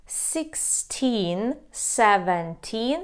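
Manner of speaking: put-on "seventeen" said slowly, the teen stretched long; teacher modelling the long teen ending; teen drawn out. Shown as a demonstration. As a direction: drag out the teen in "Sixteen, seventeen". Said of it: "Тін ми протягуємо".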